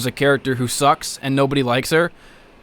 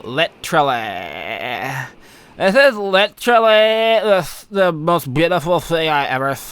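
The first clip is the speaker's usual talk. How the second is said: put-on Valley Girl accent. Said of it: "stereotypical, like, Valley Girl accent".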